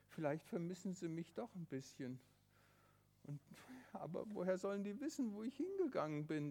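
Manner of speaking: ordinary delivery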